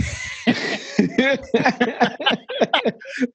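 long high laugh, real. Real, not acted. To his friend, laughing at acting casual in court, long real laugh.

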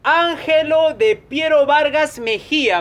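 slow, high announcer-like call; grand shout-out. Real, not acted. Host thanking a donor by full name, grand shout-out. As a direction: proclaim the name, stretching it out.

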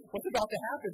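high, loud, jittery retelling of his own nervous thought. Retold or imitated. Preacher retelling his fear at the door, nervous and high. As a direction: sound startled as the lock rattles.

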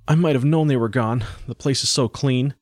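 put-on young boy working it out; realizing boy. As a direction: speak as a boy taking in his loss. Boy realizes parents are gone.

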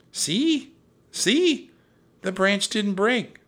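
put-on very high, loud girl's voice; high-pitched, jubilant exclaiming; triumphant excitement. A narrator's reading. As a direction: crow with delight at being proved right. Girl triumphant after crossing.

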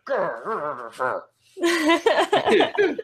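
comic stifled growling; under-the-breath growl. Retold or imitated. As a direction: growl secretly, as if seething at teammates. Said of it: "secretly under your breath".

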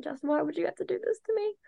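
high, quick mock wail at the news; mock despair. Hearing the big announcement on her phone, a high, fast mock wail. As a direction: whine it fast like the news betrayed you. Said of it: "and I'm like".

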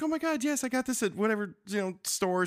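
high-pitched mock gushing; excited squeal, easing as it goes; flattered wife. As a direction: gush like a wife lapping up compliments. Voices wife eating up compliments.

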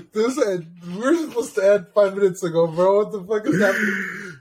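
high, laughing disbelief. Real, not acted. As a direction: laugh out the complaint. Laughing exasperated.

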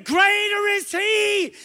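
slow, high shouted declaration; mean it. Shown as a demonstration. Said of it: "Say it like you mean it".

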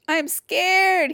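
high, loud wail of fear; scared scream. A narrator's reading. Jim can't take it anymore, screaming. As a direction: scream it, stretching the last word. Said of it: "he screamed".

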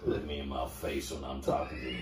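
a hint of a sultry Southern woman; deep Southern accent. Host voicing a trucker stop woman, soft low Southern accent. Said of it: "deep Southern accent".

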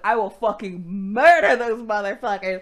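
loud imitation of a bloodthirsty warrior; hammed-up, far too intense; over-the-top menace. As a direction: swell into a mock roar on the threat. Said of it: "you have fun at eleven".

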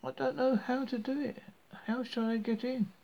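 put-on high, innocent little girl. A narrator's reading. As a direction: play dumb like a small girl. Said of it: "and said".

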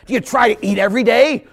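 high, loud incredulous question; mock scolding challenge. Preacher challenging the excuse-maker, loud mock scolding. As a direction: hammer the question at them.

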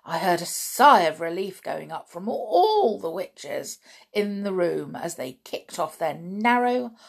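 ordinary delivery, nothing marked

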